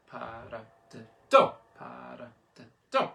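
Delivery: "para de do" hushed until a punched "do"; half-whispered syllables, final DO hit hard; whispery accent four. Shown as a demonstration. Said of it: "when I'm whispering it at you".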